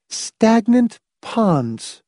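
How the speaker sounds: high, drawn-out, effortful repeat. A narrator's reading. Mother repeating her son's words, high and strained. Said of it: "repeated with an effort".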